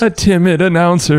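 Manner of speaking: high, shaky mock announcer; a nervous try at announcing; timid announcer. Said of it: "That sounds like your timid voice".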